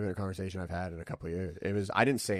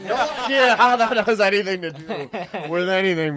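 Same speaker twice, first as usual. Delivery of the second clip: loud, high, laughing exclamation; laughing disbelief; 10 semitones higher, 10 dB louder than usual. Retold or imitated. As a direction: burst out laughing through the words. Laughing at retold absurd remark.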